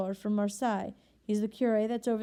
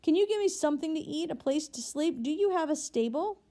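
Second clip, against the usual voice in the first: a slightly raised, earnest traveler's voice; lightly voiced weary convict; 6 semitones higher than usual. A narrator's reading. A convict asking the bishop for lodging, earnest and slightly raised. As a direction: ask for shelter like a tired stranger.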